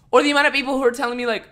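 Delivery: ordinary talk, nothing marked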